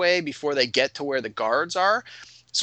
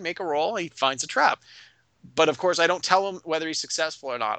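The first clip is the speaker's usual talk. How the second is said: normal talking voice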